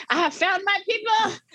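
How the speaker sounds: high, bright burst of joy; joyful exclaim. Retold or imitated. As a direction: cry it out like a revelation. Joy at learning the dance.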